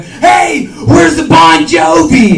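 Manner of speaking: high, loud yell across the store; shouting guy. Retold or imitated. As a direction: blurt the question for the whole store. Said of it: "So we go in there".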